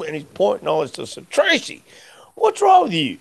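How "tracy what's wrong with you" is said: high, alarmed "Tracy, what's wrong with you?"; a pitched-up, startled plea to an angry friend; mock alarmed plea. Pleading with attacking friend.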